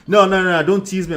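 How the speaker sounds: high, loud fake protest; mock protest. Coach mocking a man's fake protest: loud high mock whine. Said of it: "he's capping".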